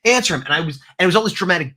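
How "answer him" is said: urgent plea. Retold or imitated.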